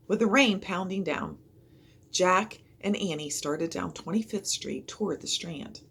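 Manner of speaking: ordinary delivery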